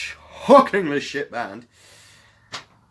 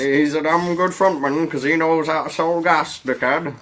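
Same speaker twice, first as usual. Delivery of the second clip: higher, louder mock fan voice; gushing fan; 7 semitones higher, 6 dB louder than usual. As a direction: rave like a devoted, belligerent fan. Acts out the band's admiring fans.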